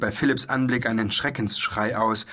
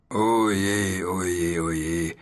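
drawn-out lament, sinking in pitch; woeful little dragon; wailing; 3 semitones lower, slower than usual. A narrator's reading. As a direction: moan it wearily, sliding downward. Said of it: "hörte Philipp ihn jammern".

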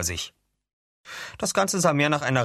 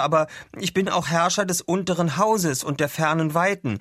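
higher-pitched, sincere self-introduction; earnest declaration; 9 semitones higher, 3 dB louder than usual. Acted. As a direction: state your titles in all seriousness. Arthur earnestly states his titles.

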